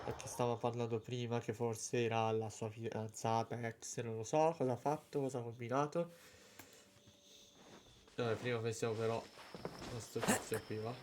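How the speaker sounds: ordinary talk, nothing marked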